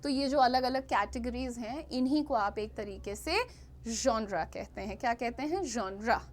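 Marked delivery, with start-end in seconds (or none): 3.9-4.5 जॉनरा as ZHON-ra; soft zh. Shown as a demonstration. Teacher naming the categories, जॉनरा with soft zh. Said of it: "ये ज और श का mix होता है". 5.6-6.2 जॉनरा as ZHON-ra, lower; soft buzzed start on जॉनरा. Shown as a demonstration. Teacher answering how it is said, soft-zh जॉनरा. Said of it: "ये ज और श का मिक्स होता है".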